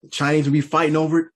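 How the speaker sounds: ordinary delivery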